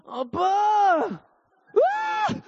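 put-on very high excited toddler squeal; toddler shriek. Toddler shrieks at dad by the fridge, high squeal. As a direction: squeal for dad like an impatient baby. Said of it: "He goes".

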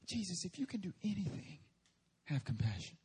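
desperate plea. Acted. Father presenting desperation to Jesus, softly begging. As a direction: whimper it like a pity plea. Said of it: "how desperate his situation really is".

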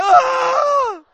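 long, loud high-pitched wail; bawling wail. Retold or imitated. Preacher re-enacting his weeping for the room, loud wail. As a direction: burst out in an uncontrollable bawl. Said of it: "it was like".

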